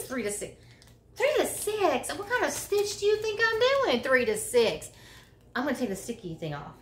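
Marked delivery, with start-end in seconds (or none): 1.2-4.0 high, indignant disbelief; mock outrage at the yarn label; incredulous. Real, not acted. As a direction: scoff at the label. Indignant at hook size label.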